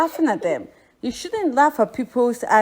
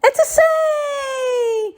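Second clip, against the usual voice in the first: high shouted "Ete seng"; excited greeting. Retold or imitated. Tourist excitedly greets a Ghanaian, shouting. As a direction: call out "Ete seng" with joy, much higher. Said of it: "You say".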